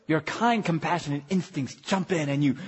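ordinary delivery

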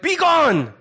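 high, falling mock command; commanding exorcist. Disciples ordering a demon out, high and commanding. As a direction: cast it out with theatrical force.

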